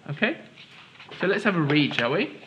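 ordinary delivery

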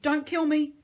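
high, loud pleading; frightened plea; begging. A narrator's reading. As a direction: plead desperately. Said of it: "he begged".

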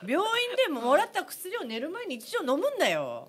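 slow, laughing Ibaraki-dialect mom friend. Retold or imitated. Mimicking a mom friend's advice in Ibaraki accent. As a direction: lay down the rule like a bossy Ibaraki mom friend. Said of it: "ママ友。".

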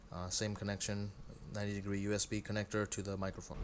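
recorded on a clip-on mic; lavalier mic sound. Real, not acted. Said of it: "Now I'm recording using the small microphone".

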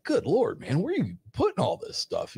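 good lord, marvel at how much she ordered; amazed. Retold or imitated. Amazed at how much the tiny date orders, high exclamation.

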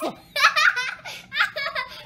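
high, loud laughing fit; real burst of laughter. Real, not acted. Reader laughing at her own dog voice, high laughter. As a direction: crack up laughing.

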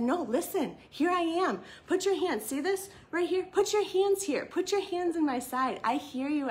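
Jesus urgently reaching out to Thomas; impassioned plea. Retold or imitated. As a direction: beg him to see, arms wide open.

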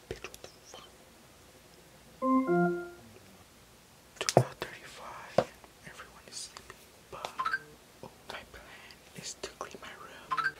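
hushed whisper to the camera. Real, not acted. Recording at night while everyone sleeps, whispering. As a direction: whisper so the house stays asleep. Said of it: "why am I whispering".

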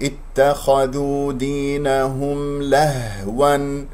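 slow melodic tajweed chant; Quran recitation. Shown as a demonstration. Teacher reciting the verse, slow melodic chant.

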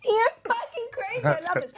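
high-pitched, delighted laughing speech. Real, not acted. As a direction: talk through real laughter. Cracking up at his pointing bit.

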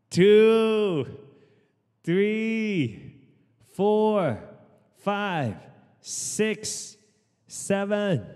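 loud, high counting for the class; energetic gym coach counting reps; fitness instructor count. Real, not acted. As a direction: call out the reps to pump the group up. Calls out workout counts.

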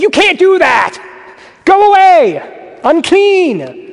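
high, loud shocked shout at a stranger; alarmed yell. Retold or imitated. Imagining a sick stranger in your face, high alarmed yell. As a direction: recoil and yell him off, stretching go away slowly. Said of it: "You're like".

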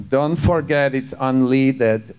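slow, imitated Israeli accent. Israeli car seller calling to the departing buyer, slow imitated Israeli accent. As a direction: imitate an Israeli woman, every syllable drawn out. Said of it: "I did an imitation of her".

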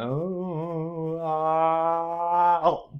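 mock surprise. Shown as a demonstration. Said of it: "And I'm like".

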